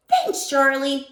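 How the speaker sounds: a hint of a small boy, slow; first grader thanking Charlie; lightly croaky little voice. A narrator's reading. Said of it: "in his croaky little voice".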